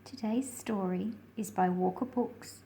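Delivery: ordinary delivery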